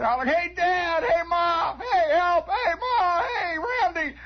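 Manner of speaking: high, frantic calling out; hollering for help. Acted. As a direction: yell for everyone across the dark lake. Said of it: "I'm hollering for everybody".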